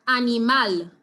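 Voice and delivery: "animal" in Spanish, final MAL punched; stress on MAL. Shown as a demonstration. Teacher showing how it is pronounced, "animal" stressed on MAL. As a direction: say a-ni-MAL, weight on the last syllable. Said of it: "on the syllable that is stressed".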